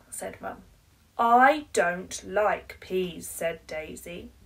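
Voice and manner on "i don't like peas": slow, loud flat refusal; stubborn child. Acted. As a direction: dig your heels in like a stubborn little girl.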